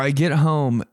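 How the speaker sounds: ordinary talk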